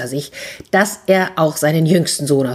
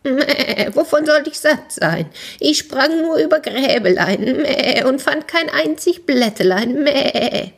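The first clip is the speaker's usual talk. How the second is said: high, bleating goat voice; high-pitched whingeing goat; complaining goat; 8 semitones higher than usual. A narrator's reading.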